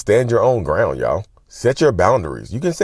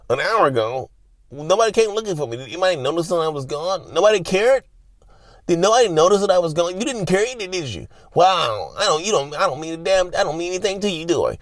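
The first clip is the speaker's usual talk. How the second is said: very high, whiny put-on voice; hammed-up hurt disbelief, pitched way up; mock wounded outrage; 9 semitones higher than usual. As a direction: imitate a self-pitying partner making a scene. Acting out the narcissist making a scene.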